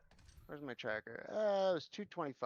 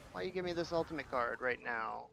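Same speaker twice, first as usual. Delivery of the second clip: ordinary talk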